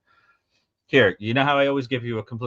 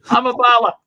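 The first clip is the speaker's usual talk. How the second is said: high, loud magical incantation; magical word; 7 dB louder than usual. Shown as a demonstration. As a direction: say it like a magic spell. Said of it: "Sounds like a magical word".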